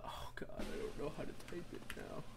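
breathless whisper to yourself; whispered fluster. Real, not acted. Streamer whispering to herself while struggling to type. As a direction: hush it out, flustered. Fumbling with typing, muttering.

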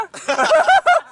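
high burst of laughter. Real, not acted. Laughing at joke.

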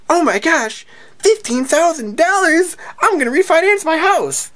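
high-pitched mock squeal of a freak-out. Appraisal guest hears the value, mock freak-out. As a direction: imitate an overjoyed winner, way up high. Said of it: "freaking out and going".

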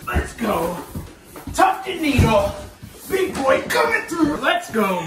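loud, high hype yell; rallying shout. Real, not acted. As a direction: holler like a strongman pumping up. Cheering the bed up the stairs.

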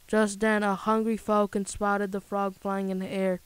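ordinary delivery

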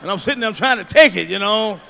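usual speaking voice

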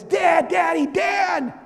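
put-on high, loud child calling for dad in the night; urgent high call of a child waking her father; little daughter calling out. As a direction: shout up at Dad like a scared little girl. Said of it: "she wakes me up like this".

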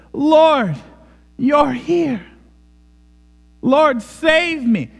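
high, loud cry for rescue; imploring cry. Acted. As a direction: cry out to the Lord, drawn out and high. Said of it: "This is not a type of cry".

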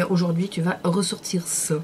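ordinary talk

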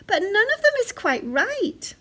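high little bear voice; Baby Bear. A narrator's reading. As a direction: explain like a fussy child. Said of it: "said Baby Bear".

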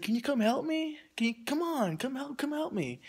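high-pitched mimicry of pleading; imitated coaxing. Stranger wanting help with recycling cans, imitated high coaxing.

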